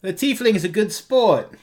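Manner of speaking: high-pitched cheer; acted cheering. Voicing a cheer for the tiefling.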